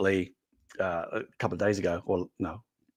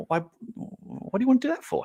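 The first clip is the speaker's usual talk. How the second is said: high, baffled mock question; puzzled colleagues; 13 semitones higher than usual. Colleagues doubting the sticker change, high baffled imitation. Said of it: "People are going".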